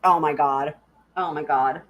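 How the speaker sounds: normal talking voice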